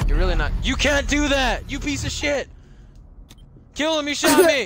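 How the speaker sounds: loud outraged yelling; furious shout. Real, not acted. Shot by a teammate, yelling in outrage. Angry outburst after being shot.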